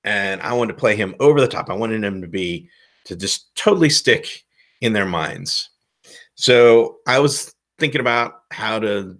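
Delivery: normal talking voice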